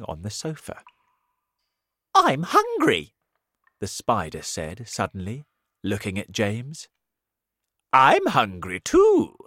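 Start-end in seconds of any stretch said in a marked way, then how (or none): 2.2-3.2 high, loud hungry spider. Acted. Spider speaks to James. 7.9-9.4 put-on old grasshopper voice.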